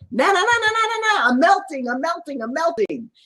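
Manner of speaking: high, squealing mock melting cry, fading out at the end; playful melting witch. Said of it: "you could be playful and go".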